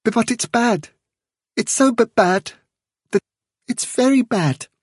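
high, stammering little voice; miserable. A narrator's reading. Fiver warning the chief of danger, miserable. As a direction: stumble unhappily over the words, pitched right up. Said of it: "he concluded miserably".

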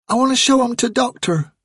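put-on a girl pleading with her father; pleading child. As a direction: beg dad to let her.

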